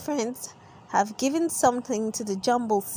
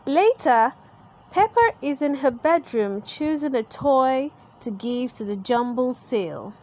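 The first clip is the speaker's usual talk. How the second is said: plain reading voice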